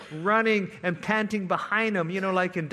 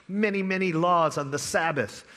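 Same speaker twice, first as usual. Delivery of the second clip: ordinary talk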